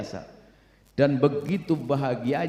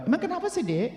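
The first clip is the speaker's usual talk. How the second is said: curious husband; 11 semitones higher, 3 dB quieter than usual. Acted. Husband asking his wife.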